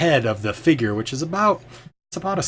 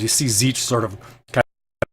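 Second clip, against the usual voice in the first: ordinary talk, nothing marked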